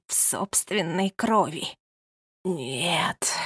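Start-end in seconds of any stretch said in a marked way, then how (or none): 2.4-3.3 snake-like hissed no, quiet; hissing like a snake. A narrator's reading. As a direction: hiss it out like a snake. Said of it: "прошипела старуха, как змея".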